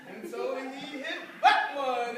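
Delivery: ordinary delivery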